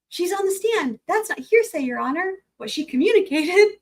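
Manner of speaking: high, emphatic attorney; imitating Elaine, indignant. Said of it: "my Elaine voice".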